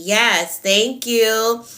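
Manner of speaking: ordinary talk, nothing marked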